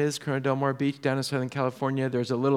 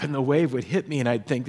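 usual speaking voice

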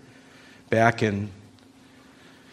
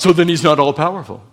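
loud, rapid mock rebuttal; smug challenger; 3 semitones higher, 6 dB louder, much faster than usual. A sceptic pouncing on a believer's concession, smug rebuttal. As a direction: jump on the admission, fast and forceful.

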